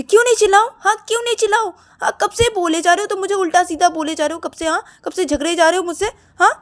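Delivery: high, loud outraged questions; angry yelling. Acted. Siya told not to shout, yells back angrily. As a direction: yell back, refusing to be hushed. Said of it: "चिल्लाओ मत".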